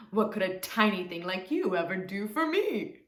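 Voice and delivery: big scornful lion voice. A narrator's reading. Lion doubting the tiny mouse, scornful. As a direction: scoff at the tiny creature.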